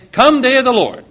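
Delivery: mock the pastors' "Come day of the Lord"; mocking quote. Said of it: "and yet they'll say".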